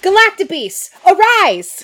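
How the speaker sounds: high, loud command to arise; summoning shout. Acted. A Ranger calling the Galactabeast into battle, shouting high. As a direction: summon the beast with a battle cry.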